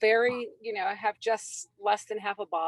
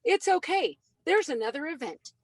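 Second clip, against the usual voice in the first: put-on higher, perked-up boy's voice; higher boy voice, recovering; upset kid voiced; 6 semitones higher than usual. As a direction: lightly play the kid bouncing back. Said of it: "And he says".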